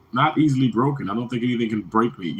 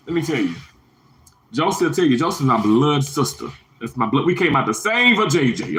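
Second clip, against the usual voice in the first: usual speaking voice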